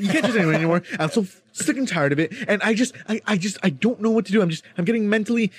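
high, stammering, whiny mock student; flustered student impression. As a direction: play the rattled classmate groping for words, pitched high. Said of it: "he's like".

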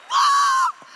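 very high loud shout of amazement; a big astonished yelp; whoa cry. Retold or imitated. Preacher reliving her amazement at the bus stop, shouting very high. Overwhelmed by the moment.

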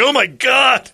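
high, loud alarmed gasp. Retold or imitated. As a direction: react in shock. Guy alarmed.

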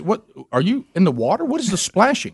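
playing the wife hearing splashes on the phone; imitating wife. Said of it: "she goes".

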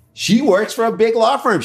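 high-pitched mock outrage; mock indignant defence. Defender bragging about her law firm, high mock-indignant voice.

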